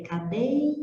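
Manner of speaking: cadeia said slow and high with the EI glide; pronunciation demo. Shown as a demonstration. Teacher showing how cadeia is pronounced, slow. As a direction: glide ca-DEI-a slowly. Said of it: "como a gente pronuncia".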